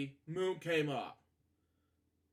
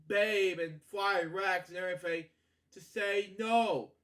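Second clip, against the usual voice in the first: higher and louder exasperated scolding; scolding character; 3 semitones higher, 3 dB louder than usual. Acted. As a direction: tell the robbers off, at a loss what to do. Voiced character scolding robbers.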